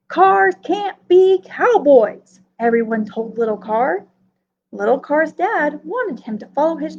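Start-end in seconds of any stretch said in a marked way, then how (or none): 0.1-2.4 louder and higher, slow and firm, each word set down; flat refusal from the crowd, said slowly; loud slow insistence. Acted. Everyone tells little Carr no, loud slow insistence.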